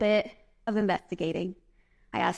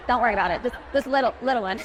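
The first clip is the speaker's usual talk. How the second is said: normal talking voice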